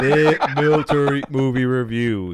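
slow, loud show announcer. Acted. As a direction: boom out the segment title slowly.

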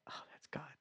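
hushed, voiceless whisper of recognition; whispered realization. Retold or imitated. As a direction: whisper it as it dawns on you. Dawning recognition, retold.

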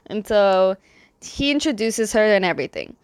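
ordinary delivery

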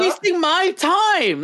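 high, slow mocking drawl; mocking James. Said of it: "mocking James".

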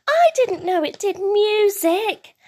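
high, slow, amazed little voice; astonished child's cry. A narrator's reading. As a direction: marvel in a high child voice, drawing it out. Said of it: "cries Bing".

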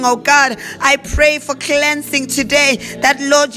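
normal talking voice

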